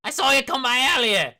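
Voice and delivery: high, loud shouting guard; angry guard. Acted. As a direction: shout them away from the door.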